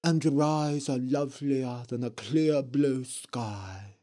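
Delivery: eerie ghost witch voice; lightly voiced spooky witch. A narrator's reading. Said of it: "said the Blue Witch".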